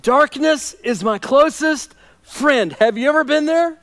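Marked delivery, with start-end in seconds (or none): 0.0-2.7 slow, higher, grieving line; drawn-out lament. Shown as a demonstration. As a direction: stretch each word like a lament. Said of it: "That's what he's saying".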